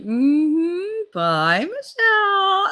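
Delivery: high, sing-song knowing goodbye. Retold or imitated. Boyfriend's mother seeing her leave, knowing tone. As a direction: stretch the name out pointedly, imitating her. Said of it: "say it in that knowing way".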